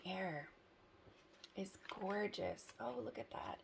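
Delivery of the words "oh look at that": ordinary talk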